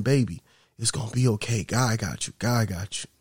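a church mother soothes a sobbing woman, softly reassuring; soothing church mother. Retold or imitated. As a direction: hush her gently like a church mother. Church mother comforting crying woman.

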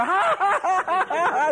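high, loud mock guffaw; imitated big laugh. Pastor imitating girlfriend and preacher sharing a joke, imitated big laugh. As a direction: imitate two people roaring with laughter.

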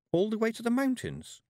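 high, lightly voiced young girl; polite girl asking up high. A narrator's reading. Tiffany asks Death.

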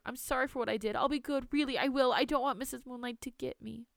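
put-on high, anxious little-girl voice; pleading child. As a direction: beg and promise like a scared child. Frightened child pleads with grandmother.

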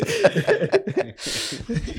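high, sustained laughter; hearty laugh. Real, not acted. Man amused by his own comeback, high hearty laugh. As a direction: burst out laughing at your own joke.

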